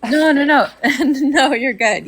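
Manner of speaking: high, loud laughing reassurance. Real, not acted. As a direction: brush off the apology through real laughter. Listener laughs off an apology.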